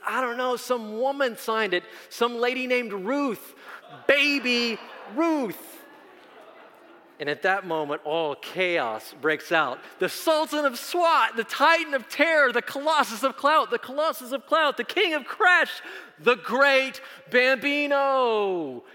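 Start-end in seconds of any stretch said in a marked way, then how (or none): 0.1-5.8 put-on high-pitched boy, slowing on the name; clueless boy. Retold boy's clueless answer in story. 10.0-18.8 put-on high, loud shouts of kids in chaos; acted-out frenzy of baseball-mad boys; awestruck uproar. Friends erupt in chaos naming Ruth.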